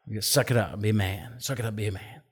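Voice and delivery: lightly voiced low, quiet tough talk. Boys told not to cry, in a low, muted tough voice. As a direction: drop low and soft, a mock manly order.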